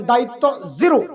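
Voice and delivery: Zero punched with a swooping rise; demonstrating a forceful zero for the crowd to copy; punched pronunciation. Shown as a demonstration. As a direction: set up দায়িত্ব, then hit zero hard. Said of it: "আমি যেভাবে বলি ওইভাবে বলবেন".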